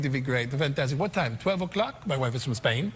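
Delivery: a light put-on Spanish accent; mock Spanish accent, understated; fake Spanish accent. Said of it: "when I put that accent on there".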